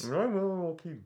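muffled behind a mask; through a face mask. Pretend. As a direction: talk through a thick mask. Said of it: "I can't even understand what you're saying".

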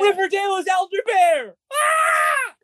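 high shrieking shout; excited shout. Acted. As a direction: yell it, losing it completely. Characters lose it, yelling.

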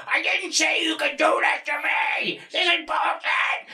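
high, drawn-out mock freak-out; bad roommate. Roommate protesting the change of plan, hammed-up high freak-out. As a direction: wail in protest as an exaggerated impression. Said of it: "freaking out".

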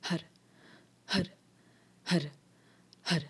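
har pushed out from the navel, one breath each; pumped har. Shown as a demonstration. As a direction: pump each har from the belly. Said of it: "you're pumping again the navel point".

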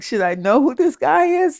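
high-pitched self-questioning; exaggerated high confusion; puzzled inner thought. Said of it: "in my mind, I'm like".

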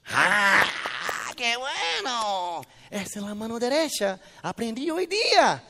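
mother showing off her son to her friends, mock tearful gushing. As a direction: ham up a mother almost crying with pride over a tiny feat.

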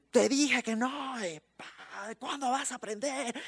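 high scolding parent voice; angry parent. Acted. Parent scolding child, acted.

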